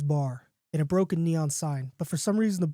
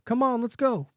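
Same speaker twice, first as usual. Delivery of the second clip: higher-pitched call to a dog; mild yell; 7 semitones higher than usual. A narrator's reading. Man calling the wolf into the bar, mild yell. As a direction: call the animal along. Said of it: "he yells to the wolf".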